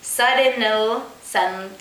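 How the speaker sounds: ordinary delivery, nothing marked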